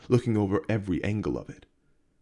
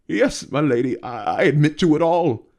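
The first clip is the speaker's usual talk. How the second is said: loud, higher, desperate admission; panicked confession; 6 semitones higher, 6 dB louder than usual. A narrator's reading. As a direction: blurt it all out in terror.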